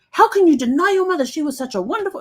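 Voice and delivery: scolding the man in the book; indignant outburst. Retold or imitated. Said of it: "I got so pissed off with this guy".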